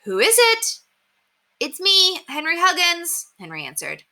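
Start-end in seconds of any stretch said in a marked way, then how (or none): 0.0-0.8 much higher, loud call down; mother calling down from an upstairs window. A narrator's reading. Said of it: "Miss Kelly called from an upstairs window". 1.6-3.3 put-on boy calling up. A narrator's reading. Henry calling up to window.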